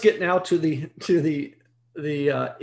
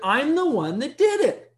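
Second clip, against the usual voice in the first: ordinary talk, nothing marked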